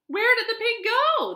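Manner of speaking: high, loud, urgent question; bewildered cry. A narrator's reading. Animals finding the pig gone, bewildered cry. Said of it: "the animals cried".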